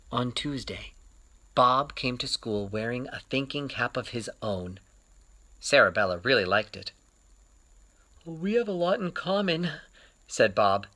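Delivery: gentle picture-book reading; storyteller reading to children; story narration. A narrator's reading. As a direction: read it like story time for kids.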